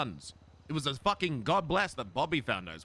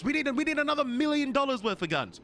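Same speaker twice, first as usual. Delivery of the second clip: worked-up rant; 7 semitones higher than usual. Real, not acted. Gang member venting to his brother about guns, worked up and high. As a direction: pour out the pressure, voice climbing.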